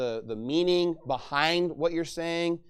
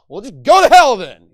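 very loud, higher-pitched shout; angry outburst; 4 semitones higher, 18 dB louder than usual. Acted. Cursing the person in a quarrel, furious yelling. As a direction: yell it as a furious curse.

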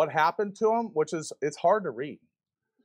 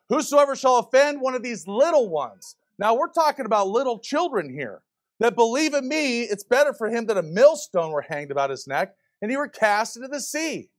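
scripture quoted in preaching; 5 semitones higher than usual. Real, not acted. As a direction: declaim the verse from the pulpit.

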